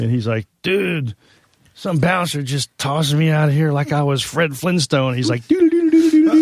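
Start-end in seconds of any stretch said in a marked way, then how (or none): 0.6-5.1 louder, higher, worked-up retelling; all riled up, tell it like it just happened; excited retelling. Retold or imitated. Bandmate bursting in backstage, loud and worked up. Dwayne retelling being thrown out. 5.5-6.4 high, loud cartoon running-feet scat; Flintstone running noise. Bandmate imitating a cartoon getaway, high loud scat. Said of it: "like, the foot motion".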